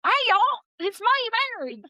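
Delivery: mock thick Southern twang; Mary's thick Southern accent. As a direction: put on an exaggerated, high-pitched Southern accent. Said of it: "suddenly she has a very thick Southern accent".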